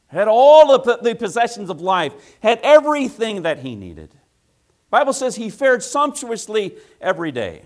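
loud, high preacher's cry; preaching at full force. Acted. Preacher building.

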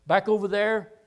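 ordinary delivery

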